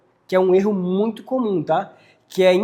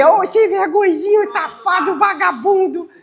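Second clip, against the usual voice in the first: put-on very high cooing baby talk; sweet insults; 12 semitones higher, 5 dB louder than usual. Shown as a demonstration. Calling a dog with insults in a loving tone, cooing. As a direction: coo the insults like pet names, high and sing-song. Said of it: "É, tu pode falar assim".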